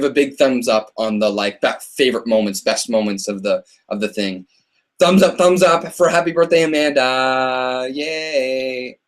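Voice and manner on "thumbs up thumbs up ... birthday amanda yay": higher cheer stretching out on the name; rally the viewers, then draw it out slowly; celebratory cheer. Real, not acted. Host cheering Amanda's birthday for viewers, celebratory cheer.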